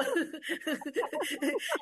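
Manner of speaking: a long light laugh. Real, not acted. Laughing after saying her anger shows on her face. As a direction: let the laugh ride up.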